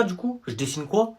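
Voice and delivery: ordinary talk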